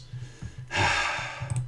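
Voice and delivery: long, breathy mock sigh, no voice; imitating a fed-up sigh; imitated sigh. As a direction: let out an exaggerated sigh. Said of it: "and he goes".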